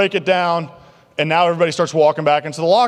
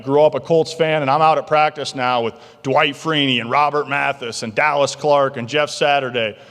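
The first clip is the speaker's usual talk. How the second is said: ordinary talk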